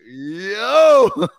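high, loud drawn-out exclamation; shocked reaction. Retold or imitated. Crowd reacts to an uncomfortable joke, imitated shocked shout. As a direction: mimic a crowd's stunned outburst.

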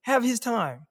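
high-pitched insistent appeal; exasperated plea. Acted. Defender asking critics to credit the cornerback, exasperated.

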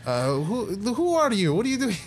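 high, flustered lab scientist; a high, cagey scientist who knows about bats; nervous scientist voice. Acted. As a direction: scientist dodging questions about his bat research.

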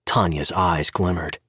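plain reading voice

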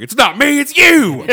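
yelled high and loud; shouting. Retold or imitated. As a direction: scream it at the crowd. Yelling at hipster crowd.

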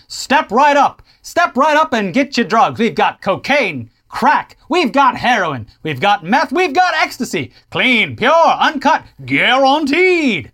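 loud carnival barker pitch; snake oil seller. Pretend. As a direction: hawk it like a fairground huckster.

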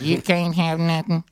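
high, slow computerized drawl; a laughing computer line judge. Pretend. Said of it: "There you go, Bubba".

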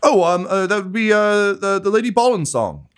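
high, hesitant workman voice; flustered worker. Acted. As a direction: fumble for the name nervously. Worker answers the steward nervously.

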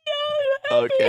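very high mock-weeping voice; imitating a crying friend; tearful. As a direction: squeak it out through hammed-up tears. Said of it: "he's just crying more".

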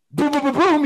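high, loud boom boom of punches; punch sounds. Retold or imitated. Player retells getting beaten in a fight, mimicking punches.

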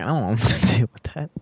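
laughing whisper, barely voiced. Real, not acted. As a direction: breathe it out through a laugh. Reluctant aside while laughing.

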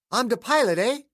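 high, mocking copy of the old man's accent; teasing imitation of an odd northern accent; imitating Clayton's accent. A narrator's reading. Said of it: "a perfect imitation of Clayton's accent".

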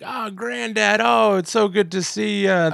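high-pitched acted delight; gushing greeting. Mark meeting his granddad, imitated gushing. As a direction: gush like a grandson reunited, playfully overdone.

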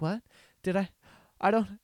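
imitating a baffled pageant contestant; halting, bewildered mock questions; confused. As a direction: stammer it as if lightly baffled. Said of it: "she's confused as hell".

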